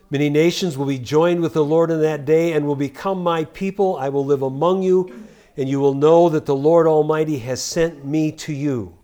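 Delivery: loud, ringing scripture proclamation; scripture reading. Acted. Preacher proclaiming the prophet's words loudly. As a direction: declaim the verse to the congregation.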